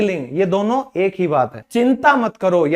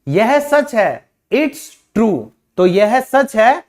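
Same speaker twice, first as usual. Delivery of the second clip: ordinary delivery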